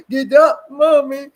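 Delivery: put-on high, loud child pleading with mommy; little boy's demand. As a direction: plead like a stubborn toddler. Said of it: "He gets up and says".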